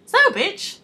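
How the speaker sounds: put-on high, loud demanding girl voice; mock girl at door. Girl turning up at the door, shrill-free high mock demand. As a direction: ham up a pushy girl on the doorstep. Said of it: "she's like".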